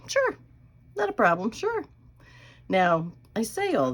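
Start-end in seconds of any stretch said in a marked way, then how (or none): 0.1-1.9 eager son. Retold or imitated. Son eagerly agreeing, quoted.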